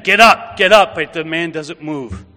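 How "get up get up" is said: loud, high barked order to get up; soldiers screaming. Retold or imitated. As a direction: yell it down at the fallen man. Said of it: "They keep screaming at him".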